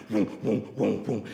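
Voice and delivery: pulsing DJ bass booms; imitated bass thump. Shown as a demonstration. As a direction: thump out a pounding club bass beat. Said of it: "gets the bass all the way up".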